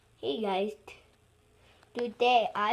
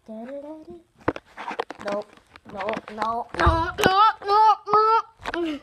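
building no; 3 semitones higher, 5 dB louder, slower than usual. Real, not acted. Escalating protest.

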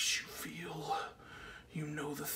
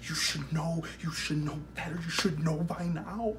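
louder, reproachful questioning; accusing; 3 dB louder than usual. Acted. Man scolds his own inner voice for lying, forceful accusation. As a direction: press hard on every why. Confronting himself about lying.

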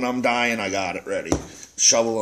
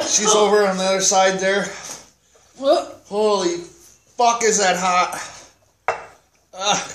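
mouth on fire; 5 semitones higher than usual. Real, not acted. Burning mouth after a spicy bite.

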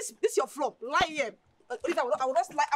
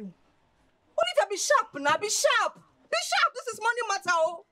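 higher, louder, building urgency; urgent pleading; 9 semitones higher, 4 dB louder, slower than usual. Acted. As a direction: pitch climbs with each "be sharp". Friend urging her, rising excitement.

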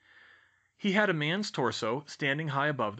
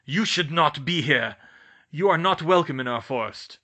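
loud, forceful warning; nasty centaur; 3 semitones higher, 8 dB louder than usual. A narrator's reading. Centaur orders humans out of his forest, nastily. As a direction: warn intruders off with hostile authority. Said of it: "the centaur said in a nasty voice".

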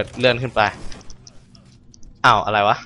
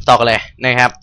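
ordinary delivery

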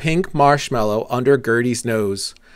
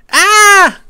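loud, high-pitched startled scream; storybook dragon's frightened scream; 10 dB louder than usual. A narrator's reading. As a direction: shriek in sudden fright. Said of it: "Gertie screamed".

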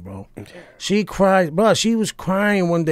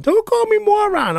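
high-pitched mock indignant kid; mad daughter. Daughter objects to dad's name-calling, mock mad. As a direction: imitate a daughter squealing back in protest. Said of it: "then she gets mad".

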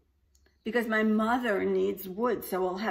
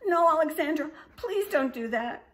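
much higher, louder whimpering plea; pleading baby dragon; 11 semitones higher, 6 dB louder than usual. A narrator's reading. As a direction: beg her, half crying. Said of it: "said the baby dragon".

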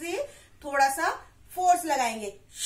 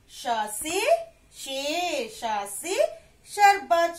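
ordinary talk, nothing marked

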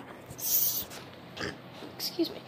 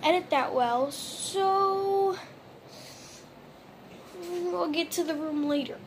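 ordinary talk, nothing marked

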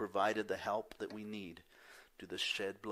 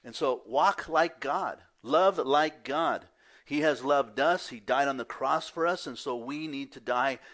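full-force preaching; 5 semitones higher, 11 dB louder than usual. Acted.

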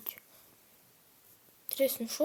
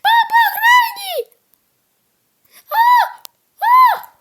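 very high loud screaming; panicked shout; 18 dB louder than usual. Real, not acted. Panicked yelling in game.

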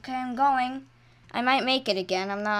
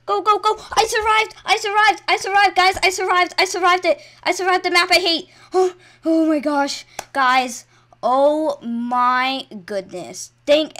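high, loud shouts of victory; ecstatic triumph; 8 semitones higher, 10 dB louder than usual. Real, not acted. Player tells viewers they survived the map they hate, ecstatic. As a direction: shriek it out, overjoyed and gasping.

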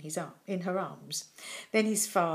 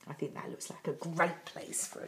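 lower, much softer contented reply; a happy dragon settling on its home; 6 semitones lower, 11 dB quieter than usual. A narrator's reading. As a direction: murmur it, pleased. Dragon approves softly.

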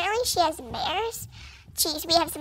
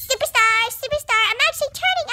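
bright rising praise, higher as it goes; excited cheer; 6 semitones higher than usual. Acted. Horse cheering itself on, higher and excited. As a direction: cheer yourself on mid-canter. Pony cheering during canter.

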